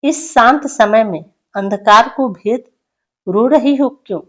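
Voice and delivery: poem recitation. Acted. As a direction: plead the question to the cuckoo in verse.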